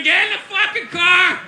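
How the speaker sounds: put-on loud, high-pitched drunken yelling; screaming argument. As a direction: holler back and forth across a parking lot. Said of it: "everybody's screaming".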